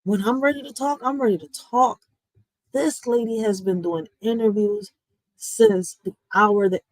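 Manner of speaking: ordinary delivery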